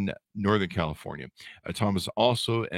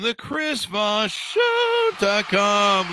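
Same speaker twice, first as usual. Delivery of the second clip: much higher, very slow, stretched-out announcer call; hammed-up barker repeat of the show name; drawn-out showman plug; 13 semitones higher, 6 dB louder, much slower than usual. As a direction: stretch every word like a carnival barker. Host announces his show twice.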